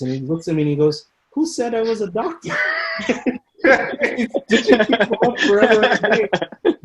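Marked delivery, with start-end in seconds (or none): 1.3-2.5 higher, cried out in mock indignation; indignant retort. Stitcher's shocking reply. 3.1-6.7 higher and louder, laughing through it. Real, not acted. Laughing at the absurd story.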